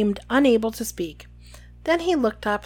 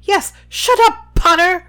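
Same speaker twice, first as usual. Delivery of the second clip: much higher, loud gleeful bark; 8 semitones higher, 7 dB louder than usual. A narrator's reading. Fudge shouting down Harry, high loud bark. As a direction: snap it at Potter with glee. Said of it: "barked Fudge".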